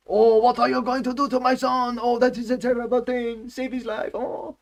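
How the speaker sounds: high wailing mock-horrified mother; silly high-pitched distraught voice. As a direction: lament like a pantomime mum. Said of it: "with the silliest voices".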